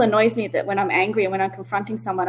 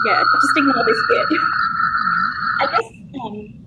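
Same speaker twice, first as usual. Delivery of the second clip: real tears; 5 semitones higher than usual. Real, not acted. To her podcast partner, brushing off real tears. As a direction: laugh off her own crying. Talking about crying, tears come.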